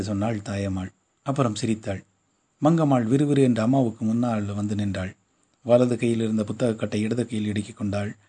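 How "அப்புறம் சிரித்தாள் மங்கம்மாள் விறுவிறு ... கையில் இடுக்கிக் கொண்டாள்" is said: plain reading voice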